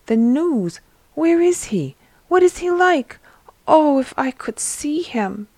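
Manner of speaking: soaring with longing to see him; paralyzed man overjoyed at the news; thrilled. Acted.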